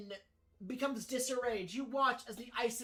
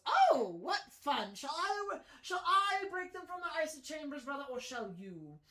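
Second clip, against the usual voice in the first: high, excited villainous brother; gleeful masked brother; 4 semitones higher than usual. Acted. Excited brother eagerly offering to free the frozen captives, gleeful. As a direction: burst in high with delight, dropping at the end.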